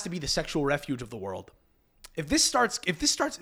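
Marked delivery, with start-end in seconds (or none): 2.2-3.4 mock alarm.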